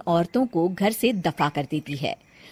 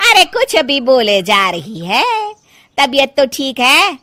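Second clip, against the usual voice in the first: loud, high mocking woman; scoffing neighbour; 5 semitones higher, 8 dB louder than usual. Mamta ridiculing Sushila before the women, loud scoffing. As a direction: laugh her claim out of the room.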